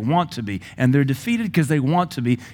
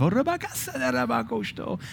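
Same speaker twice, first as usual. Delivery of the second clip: ecstatic tongues; 11 semitones higher, 5 dB quieter than usual. Shown as a demonstration. Another wave, praying in tongues.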